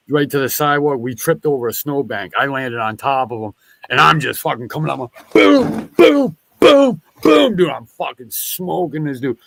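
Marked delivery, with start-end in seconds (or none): 5.3-7.6 high, loud mock punch noises; punching sound effects. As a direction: boom it out like fists landing. Acting out punches in fight story.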